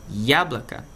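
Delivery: яблоко with stress on я, both o reduced to a; Russian pronunciation. Shown as a demonstration. Teacher shows students how яблоко sounds. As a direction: model яблоко for students, YA-bla-ka. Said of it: "a sílaba tônica está no iá".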